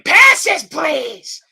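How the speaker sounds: loud, high bark for passes; yelling. Retold or imitated. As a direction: yell it like a security guard. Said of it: "security guards yelling".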